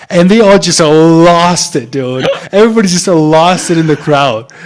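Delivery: thrilled, reliving the crowd going wild; excited. Real, not acted. Excited retelling of crowd reaction.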